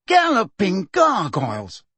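high, drawn-out astonishment; aghast, pompous oath; shocked exclamation. A narrator's reading. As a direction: exclaim in horrified surprise. Fudge recoils in shock at her face.

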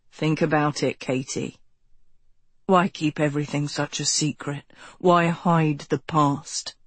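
low, persuasive male voice; low cult leader voice. A narrator's reading. Ro voiced in the story.

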